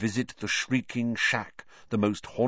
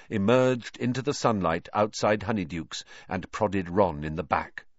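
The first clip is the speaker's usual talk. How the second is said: ordinary delivery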